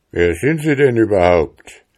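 loud, indignant bear; shouted. A narrator's reading. Bear coachman challenging an unknown passenger, loud indignant shout. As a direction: demand it loudly from the stranger. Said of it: "schrie Tobias Muffelfell".

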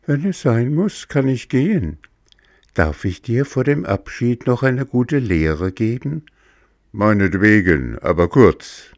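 high, patient little cricket; wise cricket voice. Acted. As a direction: lecture like a kindly insect. Cricket gives a lesson.

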